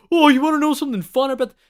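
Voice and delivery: high, loud, fast burst; mock over-eager interruption. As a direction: blurt it like an excited trivia nerd. Mimics own excited interrupting while watching.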